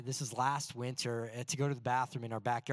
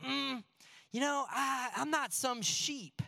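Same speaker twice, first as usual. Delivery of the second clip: proud scoff; 9 semitones higher, slower than usual. Retold or imitated. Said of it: "we go".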